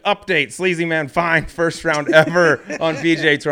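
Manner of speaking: laughing through a mocking tweet; mock announcer read. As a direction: read it like a gleeful headline.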